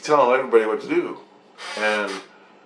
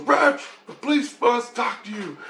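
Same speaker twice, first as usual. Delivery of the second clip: Grace; 11 semitones higher than usual. Retold or imitated. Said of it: "Grace goes".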